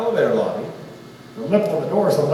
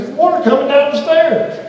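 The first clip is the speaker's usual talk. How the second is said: much higher and louder alarm; panicked old man; 10 semitones higher, 7 dB louder than usual. Retold or imitated. Old man warns of water on the stairs, panicky high cry. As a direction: blurt it out in a frightened rush. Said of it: "He goes".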